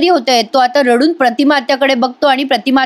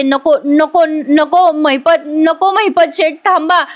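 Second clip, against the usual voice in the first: higher-pitched, drawn-out mock begging; retold panicked plea; 4 semitones higher, slower than usual. Priya begs the gunman to stop, acted panicked plea. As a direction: act out a frightened plea, stretching the repeats.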